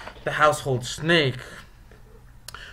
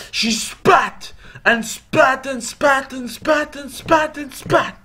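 high, slow spat; 10 semitones higher, slower than usual. A narrator's reading. Snake spitting venom repeatedly.

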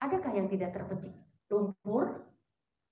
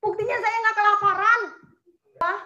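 louder and higher, fast on the last line; loud proclamation; 8 semitones higher, 9 dB louder, faster than usual. Real, not acted. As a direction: burst out with the proof. Preacher proclaiming provision.

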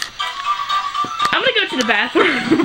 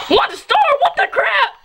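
surprised laughing shout; 11 semitones higher than usual. Real, not acted. Surprised at game ending.

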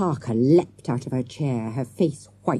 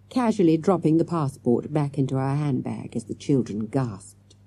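usual speaking voice

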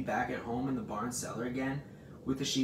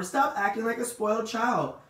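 high, loud scolding; scream; 10 semitones higher, 6 dB louder than usual. A narrator's reading. Pig scolds lazy rat, screaming. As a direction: yell the scolding in exasperation. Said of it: "screamed Wilbur".

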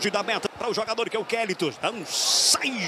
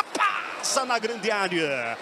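ordinary delivery

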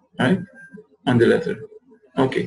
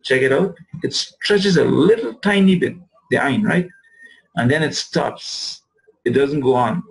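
usual speaking voice

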